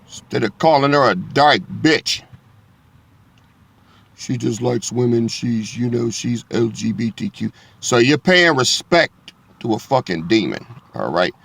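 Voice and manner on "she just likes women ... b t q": a hint of a soft, hushed churchgoer; softer, understated mocking voice; mock-polite church talk. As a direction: lightly mimic someone tiptoeing around the issue. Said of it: "See, that's what they do in church".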